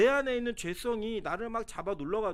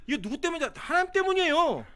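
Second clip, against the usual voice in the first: high, loud indignant protest; Jonah's outburst; 8 semitones higher, 6 dB louder than usual. Acted. Jonah angrily blaming God, loud high indignant outburst. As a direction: shout it back at God in frustration.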